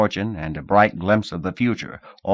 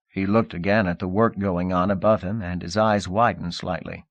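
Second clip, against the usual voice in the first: usual speaking voice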